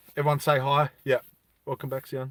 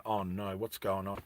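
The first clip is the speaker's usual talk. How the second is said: panicked inner thought; 7 semitones lower, 14 dB quieter than usual. Retold or imitated. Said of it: "Big Tommy's mum's like".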